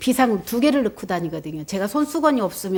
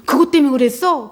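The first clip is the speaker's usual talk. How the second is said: louder, higher, after a gasp; shocked question; 4 semitones higher, 6 dB louder than usual. Retold or imitated. Learning why the monk hated her, a shocked exclamation. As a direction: blurt it out in disbelief. Gasps at the monk's confession.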